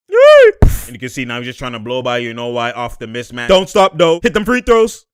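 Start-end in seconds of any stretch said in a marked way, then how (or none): 3.5-5.1 louder, higher shout at the screen; urging shout. Real, not acted. Yelling advice at the player on screen, urgent. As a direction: yell it at the player.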